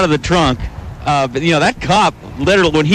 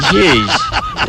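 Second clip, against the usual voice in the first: shocked laugh. Real, not acted. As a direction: burst out laughing. Reacting to crash nearby.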